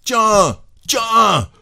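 high, explosive yells; shouting. A narrator's reading. Husband slapping his wife in rage, shouting. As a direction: cry each word out like a blow. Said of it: "et criant".

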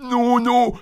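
high-pitched cry of protest; terrified cry. A narrator's reading. Cantor about to be drowned, high panicked cry. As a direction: wail it in panic, voice way up. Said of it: "cria le chantre".

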